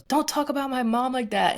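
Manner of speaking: high, indignant defence; angry retort. Retold or imitated. Little sister defending mom to dad, high and indignant.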